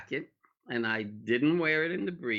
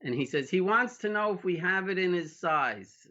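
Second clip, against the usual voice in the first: ordinary talk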